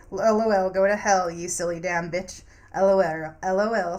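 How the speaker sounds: a loud teasing jeer; loud mock laughing jeer. Loud mocking taunt.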